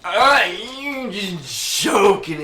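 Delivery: put-on loud, high, drawn-out drunken protest; drunk feller. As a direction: laugh off the threat loudly and slowly. Drunk brushing off a threat.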